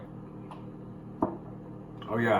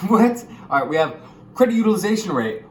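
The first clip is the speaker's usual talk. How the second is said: higher, louder, fast burst; sudden energetic restart; jolted restart; 8 semitones higher, 7 dB louder than usual. Real, not acted. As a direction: jump back in with energy. Snapping out of a stumble.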